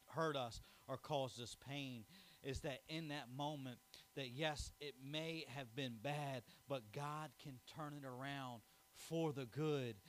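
usual speaking voice

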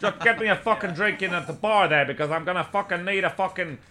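loud, high New York bellow; shouted drink order. Retold or imitated. Man on the toilet calling to the bar, imitated shouting. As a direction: holler it like a demanding barfly, imitated.

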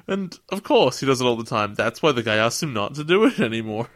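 ordinary delivery

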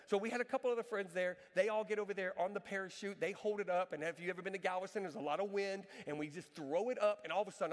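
usual speaking voice

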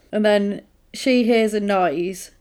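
ordinary delivery

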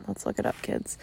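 put-on whispered aside to the kids. Shown as a demonstration. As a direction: drop to a hushed whisper for the kids. Repeats line, then whispers it.

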